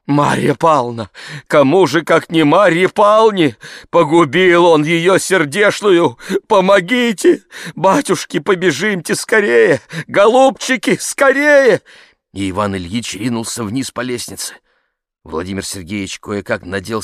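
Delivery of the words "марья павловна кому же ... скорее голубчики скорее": high, loud, distraught cry rising in urgency; frantic plea. Acted. Ivan Ilyich begs them to run to the pond, frantic. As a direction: beg for help in mounting panic. Begging for help after a drowning.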